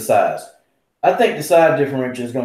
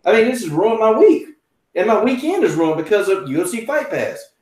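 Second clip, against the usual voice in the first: retold complaint; 8 semitones higher than usual. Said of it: "I said".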